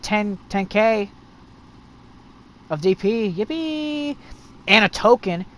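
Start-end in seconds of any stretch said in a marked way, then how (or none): 3.3-4.2 high, soft drawn-out mock yippee; underwhelmed little hooray; mock cheer. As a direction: cheer half-heartedly, holding the last vowel. Sarcastic cheer about poor farming reward.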